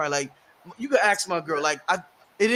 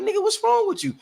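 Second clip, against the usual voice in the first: high mocking girlfriend voice; pitched-up girlfriend quote; quoting his girl; 13 semitones higher than usual. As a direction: tease him like his girl did. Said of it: "She like".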